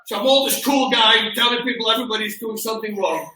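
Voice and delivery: ordinary talk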